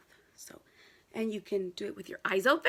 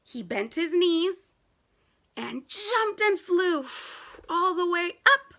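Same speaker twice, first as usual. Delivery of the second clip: dramatic excitement; 8 semitones higher, 7 dB louder than usual. A narrator's reading. Teacher acting out the dive for kids, excited. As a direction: ride the leap up and down.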